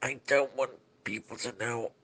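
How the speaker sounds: quiet confession. Acted. Embarrassed confession.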